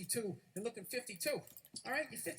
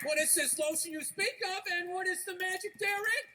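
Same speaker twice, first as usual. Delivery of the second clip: higher, theatrical wonder; mock-archaic bafflement; 6 semitones higher, 3 dB louder than usual. Clueless audience asking about lotion, mock-grand wonder. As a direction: marvel at it like some ancient innocent.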